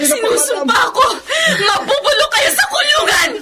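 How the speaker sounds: high, raging shouts with sobs; furious screaming. Acted. Sister screaming at her sister's attackers in jail. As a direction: scream curses at them through tears.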